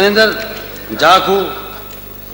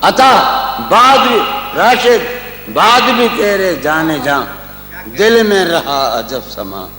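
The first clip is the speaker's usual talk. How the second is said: tender romantic poetry. Acted. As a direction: recite it lovingly, slowing down. Recites ghazal couplets.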